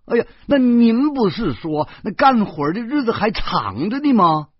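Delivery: high, loud, eager questioning; cling to the hope of being hired; hopeful, eager. A narrator's reading. Job-seeker to employer, full of hope, eagerly insistent. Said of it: "大姐满怀希望地说".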